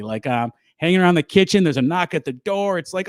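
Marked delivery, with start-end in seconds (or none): none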